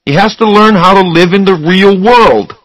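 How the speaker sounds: high, loud, heated insistence; angry. A narrator's reading. Charlie arguing with Susanna about Raymond, angry. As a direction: argue it forcefully, pitch well up. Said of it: "Charlie got angry".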